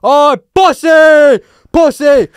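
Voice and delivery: high, drawn-out shout; imitated jeering call; mock taunting yell. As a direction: holler an insult across a shop. Man yelling taunts across shop.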